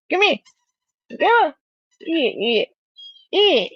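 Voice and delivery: rile the dog up playfully; high excited voice teasing the dog; excited dog talk. Real, not acted.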